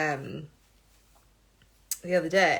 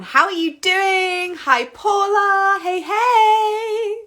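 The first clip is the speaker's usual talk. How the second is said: high, slow, louder sing-song hellos; excited greeting; 12 semitones higher, 7 dB louder, much slower than usual. Real, not acted. Greeting live viewers by name.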